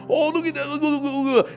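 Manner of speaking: high, eager tourist pointing at sheep; excited tourist. Retold or imitated. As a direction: gush like a delighted tourist filming. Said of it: "You can hear him talking".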